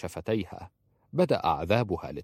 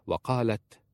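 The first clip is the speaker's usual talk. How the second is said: plain reading voice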